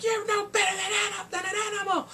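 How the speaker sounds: high, crying insult; mock tearful outburst. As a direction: spit it out through tears. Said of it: "she's like".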